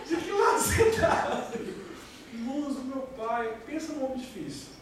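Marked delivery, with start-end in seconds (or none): 0.1-1.5 high, slow, laughing through the words; laughing question. Real, not acted. Preacher repeating his protest to Jesus, laughing. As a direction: ask it again while still laughing.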